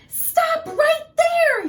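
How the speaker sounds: very high, loud, slow command; Granny stopping the wolf; yell. Acted. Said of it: "yelled Granny".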